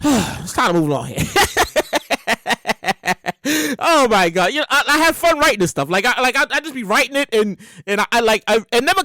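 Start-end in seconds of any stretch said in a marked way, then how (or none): 1.3-3.7 long staccato laugh. Real, not acted. Comic amused by own routine, real laughing. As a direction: crack up at your own bit.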